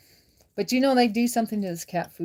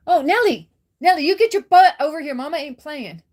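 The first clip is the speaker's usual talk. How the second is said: high, loud cat scolding; raised-voice telling-off of a naughty pet; 6 semitones higher, 9 dB louder than usual. Real, not acted. Scolding cat stealing food.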